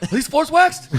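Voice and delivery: loud, high-pitched paranoid question; mock alarm. Friend teasing comic about fear of wax, mock-alarmed shout.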